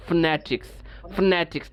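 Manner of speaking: "ফনেটিক্স" as fne-tiks, f running into n; quick elided pronunciation. Shown as a demonstration. Teacher drilling students to move quickly, "ফনেটিক্স" as fnetiks. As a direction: drop the first vowel of "ফনেটিক্স". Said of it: "দ্রুত চলে যাবো".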